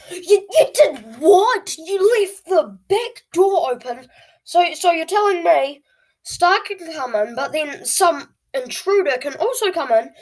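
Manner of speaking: high, loud, incredulous outburst; outraged disbelief. Retold or imitated. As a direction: blow up at the dad in shocked disbelief. Outraged at door left open.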